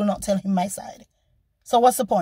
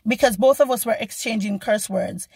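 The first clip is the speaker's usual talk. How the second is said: ordinary talk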